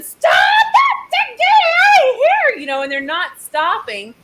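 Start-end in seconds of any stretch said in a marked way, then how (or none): 0.0-2.5 high, loud, drawn-out scream. Retold or imitated. Storyteller at pecking ostriches, screaming. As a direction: shriek it at full pitch, stretching the words. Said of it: "I'm screaming".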